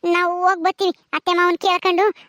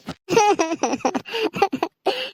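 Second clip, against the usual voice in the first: acted sobbing. Daughter upset over her sick father, sobbing. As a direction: burst of stage sobs. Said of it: "ನೀನ್ ಯಾಕ್ ಅಳ್ತೀಯ".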